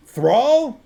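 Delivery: loud, high incredulous shout. A narrator's reading. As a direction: shout the word back at him. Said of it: "shouted Vimes".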